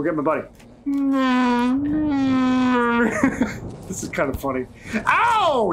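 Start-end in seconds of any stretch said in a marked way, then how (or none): 5.0-5.7 sharp high yelp of pain. Real, not acted. Character hurt in game.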